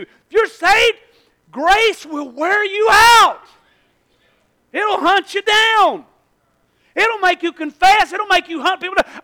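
loud, high, slow preaching; full-force preaching. Acted. Preacher roaring about grace to the congregation. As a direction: hammer it home to the church.

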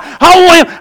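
ordinary talk, nothing marked

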